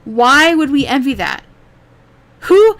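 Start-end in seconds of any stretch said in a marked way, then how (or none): 2.4-2.8 sharp, high, loud burst of disbelief; incredulous who. Real, not acted. Vlogger scoffing at the streamer's claim that guys want her, loud and incredulous. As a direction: blurt it out, refusing to believe it.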